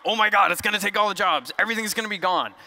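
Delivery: high-pitched mock panic over doom; exaggerated alarmist wail, played for effect; mock freak-out. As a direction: imitate everyone freaking out, pitch jumping up. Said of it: "Everyone freaks out".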